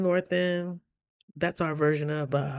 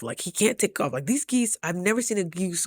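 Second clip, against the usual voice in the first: ordinary talk, nothing marked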